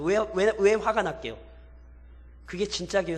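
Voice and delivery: normal talking voice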